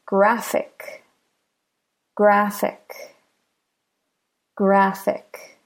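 "graphic" with GRAPH stressed; stress on second-last syllable. Shown as a demonstration. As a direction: say "graphic" with the beat on GRAPH, ic lighter. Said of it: "Stress the second to last syllable".

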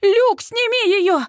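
high, drawn-out frantic cry; panicked, stretched high shout; hysterical. A narrator's reading. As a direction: beg for help in a panic. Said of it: "Я бьюсь в истерике".

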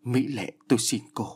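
short, startled plea; crying out. A narrator's reading. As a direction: call out suddenly and beg. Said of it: "bà chợt kêu lên".

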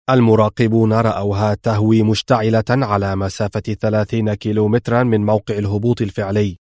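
ordinary delivery, nothing marked

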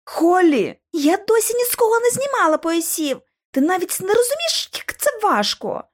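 high, flustered protest; exasperated urgency. A narrator's reading. Girls bicker while unhooking the belt, flustered. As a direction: argue in a high, agitated voice. Flustered argument during rescue.

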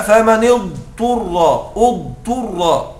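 فمن اضطر drawn out slowly as Quran recitation. Shown as a demonstration. Teacher slowly reciting the verse فمن اضطر for students. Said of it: "قال الله تعالى".

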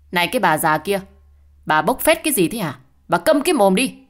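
loud, high, losing all control by the end; daughter-in-law shrieking at her mother-in-law; furious yell. A narrator's reading. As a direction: scream at the old woman to shut up. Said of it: "tức tối, gào lên mất bình tĩnh".

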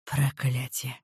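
low hissed curse. A narrator's reading. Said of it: "прошипел Альвир".

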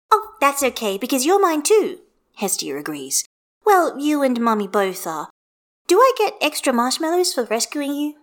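put-on a happy kid bargaining with her daddy; cheerful little girl. A narrator's reading. Child figure Hestia.

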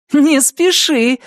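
high, sharp battle cry; shout. A narrator's reading. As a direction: yell it mid-swing. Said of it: "крикнула Аза".

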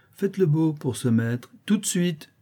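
high, drawn-out teasing command; mocking dog trainer. A narrator's reading. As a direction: make him beg like a dog. Boy orders brigand like dog.

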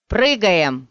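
loud, urgent rallying shout; shouted order. A narrator's reading. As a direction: shout the command to the team. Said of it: "крикнул он".

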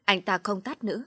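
plain reading voice

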